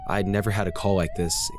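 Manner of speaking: ordinary delivery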